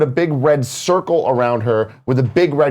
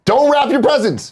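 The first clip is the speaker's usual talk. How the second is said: raised, high rallying shout; calling out; 9 semitones higher than usual. Real, not acted. Co-host cheering on Jen against wrapping, shouted. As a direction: shout it like a slogan.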